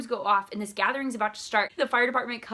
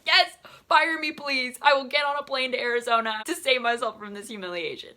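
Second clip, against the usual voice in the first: loud, raised mock begging; over-the-top humiliated outburst; hammed-up desperate plea; 5 semitones higher, 7 dB louder than usual. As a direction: beg dramatically to be let go. Retold begging to be fired.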